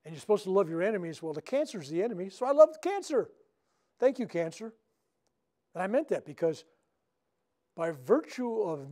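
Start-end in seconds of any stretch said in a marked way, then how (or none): 2.3-4.7 bursting with joy at his own paradox; excited declaration. Real, not acted. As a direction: pitch leaps high on love the cancer. Joyful turn in healing talk.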